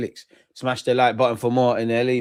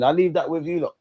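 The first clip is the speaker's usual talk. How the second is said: ordinary talk, nothing marked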